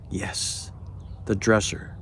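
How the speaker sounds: usual speaking voice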